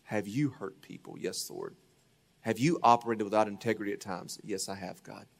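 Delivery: contrite quiet answers. Retold or imitated. [name] answering the Lord, quiet and contrite. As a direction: answer God humbly, barely above a murmur. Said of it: "I said".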